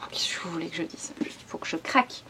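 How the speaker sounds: whisper. Real, not acted. Confiding her weakness for pretty books.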